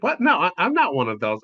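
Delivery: retold flustered denial. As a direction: protest a little too hard. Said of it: "I'm just like".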